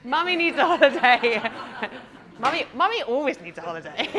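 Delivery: loud laughing reply; loud laughter through the words. Real, not acted. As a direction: laugh the joke out. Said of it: "laughing answer".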